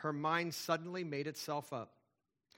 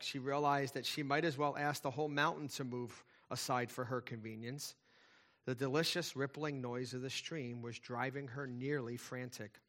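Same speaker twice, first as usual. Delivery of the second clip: ordinary talk, nothing marked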